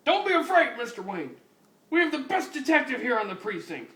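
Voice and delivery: high, loud and fast boasting; excited commissioner. Acted. Commissioner boasting about RoboCop, high loud excited call. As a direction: hype up the best cop.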